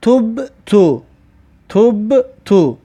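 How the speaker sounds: تب تو spelled out very slowly; slow recitation. Shown as a demonstration. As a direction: draw out تب تو for the class. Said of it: "در بین کلمه باشد میشود که".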